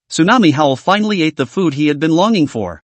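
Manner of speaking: ordinary delivery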